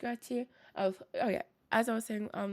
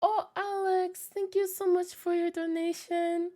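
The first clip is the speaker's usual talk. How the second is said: high and bright, dragging the oh; excited thanks; 6 semitones higher than usual. Real, not acted. Streamer gushing thanks to Alex for his donation. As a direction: gush over the donation.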